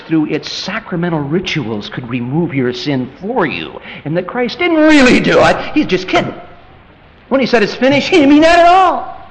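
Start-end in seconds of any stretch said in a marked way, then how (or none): 4.0-9.2 higher, rising mock incredulity; sarcastic, high-pitched incredulity; mocking disbelief. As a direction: scoff at the absurd claim. Mocking a doubter's view.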